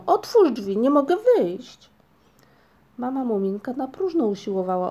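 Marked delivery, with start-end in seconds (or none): 0.1-1.9 trapped shout. A narrator's reading. Trapped, shouting through wall.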